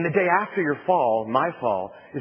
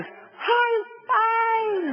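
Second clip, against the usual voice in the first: "I'm fine" shrieked much higher, cracking with panic; high panicked wail that says the opposite of fine; terrified shriek. Shown as a demonstration. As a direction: scream "I'm fine" like a man dangling over the void. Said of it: "So what you yell back is".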